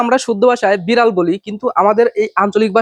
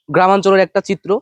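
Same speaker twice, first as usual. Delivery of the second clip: normal talking voice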